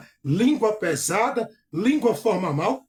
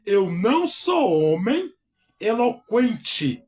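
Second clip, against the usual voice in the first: devagar, enfático; 3 semitones higher, slower than usual. Shown as a demonstration. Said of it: "Olha só".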